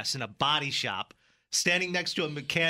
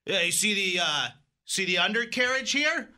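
higher, mock-grave mechanic voice; mechanic Rico; 6 semitones higher, 4 dB louder than usual. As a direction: point out the damage, hammed-up. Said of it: "standing next to a mechanic named Rico".

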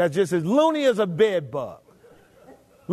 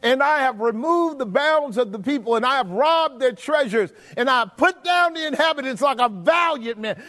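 higher-pitched, bragging scripture reading; boastful king; 4 semitones higher than usual. Acted. Preacher acts out the boasting king for the congregation, bragging. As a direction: read it as a king boasting of his conquests. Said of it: "Do you see him boasting".

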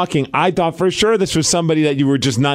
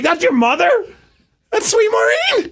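shocked disbelief. Retold or imitated. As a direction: shriek in mock disbelief. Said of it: "And I'm like".

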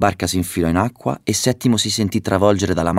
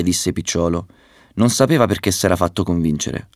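usual speaking voice

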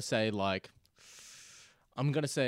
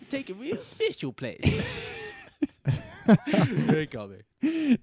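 laughing; 9 semitones higher, 6 dB louder, much slower than usual. Real, not acted. Still laughing recalling the bit.

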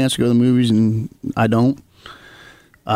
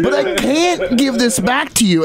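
mock dad; 10 semitones higher, slower than usual. Dad voiced in story.

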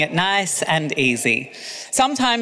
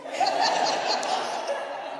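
quiet unvoiced giggle; soft breathy laugh; 8 dB quieter than usual. Real, not acted. Laughs at own reading.

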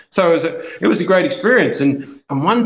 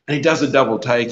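ordinary delivery

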